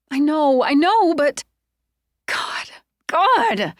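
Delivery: high, loud anguished cry; overwhelmed, near tears; distressed. Acted. Upset Sonia protesting to Trey in distress.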